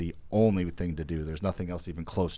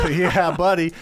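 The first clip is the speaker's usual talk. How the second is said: loud laughing yeah buddy; excited cheer; 9 semitones higher, 8 dB louder, slower than usual. Real, not acted. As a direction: hype it up with a laugh. Laughing hype for friend.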